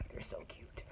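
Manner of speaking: gushing whispered aside. Real, not acted. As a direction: breathe it out in a whisper, adoring. Gushing whisper about neighbor kids.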